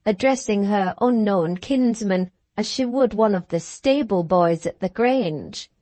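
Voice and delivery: plain reading voice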